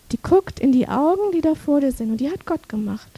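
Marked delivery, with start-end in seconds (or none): none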